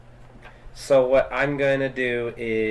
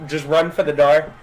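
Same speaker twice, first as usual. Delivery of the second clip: ordinary talk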